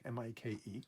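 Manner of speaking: ordinary talk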